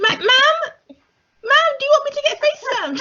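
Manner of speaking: high, fast fake-polite pleading; hammed-up posh politeness; laughing fake-courteous plea; mock polite voice. Mocking polite request continues.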